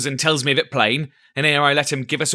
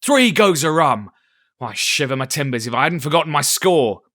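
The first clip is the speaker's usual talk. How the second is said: comic dismay; 4 semitones higher, 7 dB louder than usual. A narrator's reading. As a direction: ham up the dismay.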